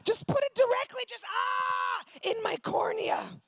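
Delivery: screaming in labor; desperate scream. Retold or imitated. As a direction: shriek for the needle. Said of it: "I was like".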